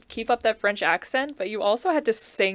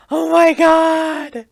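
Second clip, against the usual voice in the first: loud, high, drawn-out exclamation; excited outcry; 6 semitones higher, 10 dB louder, much slower than usual. Real, not acted. Host reacting to the guest naming Zav the Baker, excited outcry. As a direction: cry it out in delighted recognition, stretched long.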